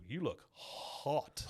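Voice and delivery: slow, dragged-out stunned "You look hot"; Hank's stunned compliment. Retold or imitated. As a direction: let "You look hot" crawl out in shock. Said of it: "that's what he says".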